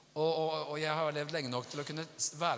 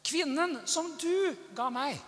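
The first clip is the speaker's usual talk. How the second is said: high, slow, accusing protest; Adam making excuses; 10 semitones higher, 4 dB louder, slower than usual. Retold or imitated. Adam excusing himself to God, high blaming protest. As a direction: push the blame away, pitched up. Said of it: "Mannen unnskylder seg".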